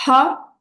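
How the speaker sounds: isolated "ha" with a strong pharyngeal H; throaty Arabic ha. Shown as a demonstration. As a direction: push the H of "ha" out from the back of the throat. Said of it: "qui sort vraiment du fond de la gorge".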